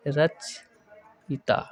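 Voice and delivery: रचयिता drawn out very slowly; slow model word. Shown as a demonstration. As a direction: stretch each syllable of रचयिता. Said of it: "इसको हम लिख सकते हैं". (धीमा, साफ़ उच्चारण: रचयिता)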